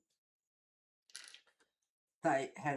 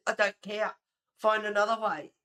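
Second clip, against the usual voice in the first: raised voice, higher and insistent; loud retold refusal; 5 semitones higher, 7 dB louder than usual. Streamer retelling refusing a pushy concertgoer, loud refusal. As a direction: shut the request down loudly.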